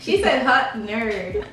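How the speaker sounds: slow, giggly repeat of the compliment; laughing echo. Real, not acted. Guest echoing the host's compliment, laughing. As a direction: savor it through a laugh.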